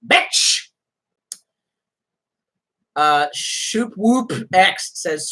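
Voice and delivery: usual speaking voice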